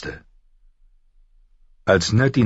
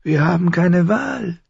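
much higher, oily eunuch voice; murmuring Varys; 12 semitones higher than usual. Acted. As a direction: murmur it smoothly as the courtier. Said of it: "murmelte Varys". (murmelnd, salbungsvoll)

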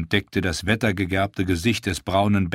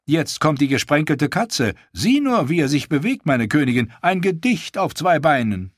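pleased; 6 semitones higher than usual. A narrator's reading. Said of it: "sagte Hitzda zufrieden".

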